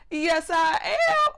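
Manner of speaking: high, loud, drawn-out answer; emphatic yes. Retold or imitated. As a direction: stretch it out loud and eager. Eager answer about food, drawn out.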